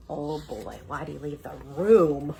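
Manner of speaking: low gruff dog voice; worried cartoon dog muttering; Scooby voice. Scooby voiced reacting in story.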